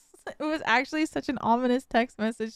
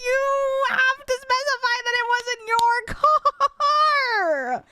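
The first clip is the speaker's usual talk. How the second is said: hammed-up outrage, stretching the last word; exasperated yell; 12 semitones higher, 4 dB louder than usual. Daughter scolding mom over unclear text, exasperated yell.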